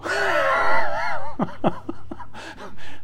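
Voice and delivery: high, hearty laugh. Real, not acted. Preacher laughing hard at his own story.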